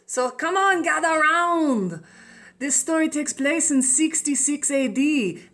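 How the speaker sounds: attempted French accent. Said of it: "I'm gonna just go with French".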